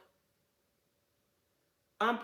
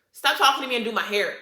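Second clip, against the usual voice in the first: louder and quicker, fed up; annoyed retort; 3 semitones higher, 8 dB louder, faster than usual. Retold or imitated. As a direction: snap back at the chatty stylist, retelling it. Retelling telling stylist off.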